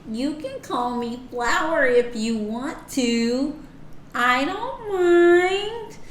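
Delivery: high, slow sweet cartoon character; vintage cartoon voice. Shown as a demonstration. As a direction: stretch it out like an old Disney cartoon. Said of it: "a cartoon voice".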